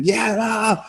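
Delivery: high, slow yelling over the noise; strained high plea over the racket; mock yell. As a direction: call out over the leaf blowers. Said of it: "yelling at him".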